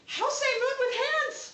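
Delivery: high voice of a serious small nephew; all-business little boy. Retold or imitated. Small nephew asks aunt how to sign moon, serious child voice. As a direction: imitate a child's earnest question, high and loud. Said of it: "It's just all business".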